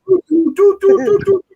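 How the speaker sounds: high, far-off sung syllables; mock tune. Speaker mocking her binge all day, high sung do-do-do. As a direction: rattle off a squeaky doo-doo-doo. Mimicking nonstop drug use.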